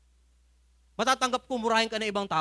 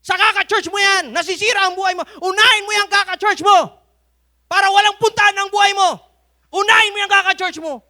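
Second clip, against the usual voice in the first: high, loud scolding shout; angry father; 13 semitones higher, 12 dB louder than usual. Retold or imitated. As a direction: yell bitterly at the churchgoing son. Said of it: "Sabi sa akin ng tatay ko". (galit na sigaw ng tatay sa anak, mataas at malakas)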